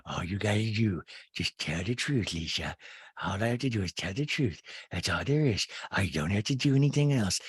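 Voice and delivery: mock-earnest low rambling; Rinna. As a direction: drag it out in a hushed put-on voice. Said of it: "Did she talk this way during sex?".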